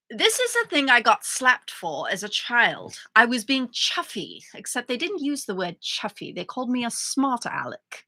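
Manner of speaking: put-on British reading voice; British accent. Reading to co-host on request, British accent. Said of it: "Read it, British".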